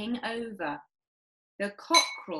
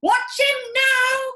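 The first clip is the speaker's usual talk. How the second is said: slow, high, loud cry; father crying out to watch the cockerel; excited cry; 11 dB louder, slower than usual. Acted. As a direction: point at the bird, thrilled. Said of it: "cried Mister Cranky".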